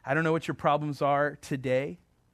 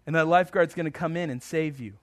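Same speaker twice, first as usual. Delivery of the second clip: ordinary delivery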